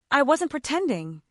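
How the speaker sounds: plain reading voice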